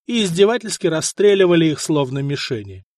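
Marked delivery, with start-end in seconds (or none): none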